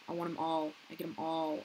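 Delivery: normal talking voice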